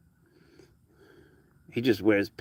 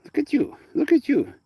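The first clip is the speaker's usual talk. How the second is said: very high cooing pet voice. Real, not acted. Owner coos at her cat, very high pet voice. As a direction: squeak it up like talking to a kitten.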